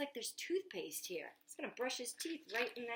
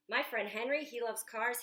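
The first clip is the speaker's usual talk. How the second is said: plain reading voice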